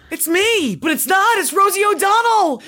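high, loud disbelieving cry; excited shout. Real, not acted. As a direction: blurt the realization loudly. Hearing the clip, reacting loudly.

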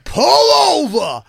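high, loud, drawn-out command; furious cop shout. Retold or imitated. Enraged cop ordering Patrice over, drawn-out shout. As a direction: bellow it at the car. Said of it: "he went".

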